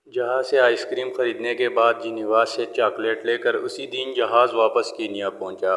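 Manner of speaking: ordinary delivery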